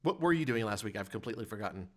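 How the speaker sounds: ordinary talk, nothing marked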